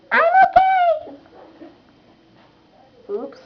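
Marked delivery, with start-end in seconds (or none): 0.1-1.2 much higher, slow reassurance; a high little voice. Acted. Character after falling, ouch.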